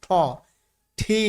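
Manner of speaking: ঠ then ঠি, each with a puff; isolated model of ঠ and ঠি; aspirated T sound. Shown as a demonstration. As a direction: build from ঠ to ঠি, strong breath on the t. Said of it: "ট না কিন্তু".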